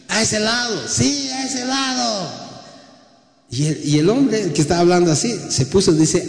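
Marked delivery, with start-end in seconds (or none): 0.1-2.4 very high, slow, drawn-out call to the boat; the Lord calling. Acted. The Lord tells the fishermen which side to cast, slow high call. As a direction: stretch it out and send it over the water. The Lord directs the net again.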